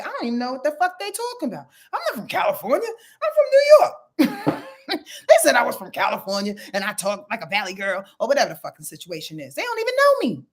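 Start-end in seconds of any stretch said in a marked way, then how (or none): 0.0-6.6 high, mock-indignant Cynthia; lightly voiced friend setting the record straight. To the gossiping group, a high mock-indignant correction. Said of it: "when Cynthia was like". 9.5-10.4 high mock protest. Voiced Cynthia, then plain repeat.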